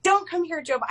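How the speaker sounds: high, urgent mock plea; playfully begging; pleading. Begging a star to stay away.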